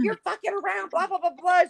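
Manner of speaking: dismissive high-pitched impersonation; mimicked nagging ex. Mimics ex's accusations.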